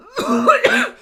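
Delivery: harsh, loud, choking cough; violent coughing fit. Acted. Fosca breaks off mid-sentence, a loud choking cough. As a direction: cough hard as if you cannot stop.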